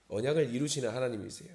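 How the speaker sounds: normal talking voice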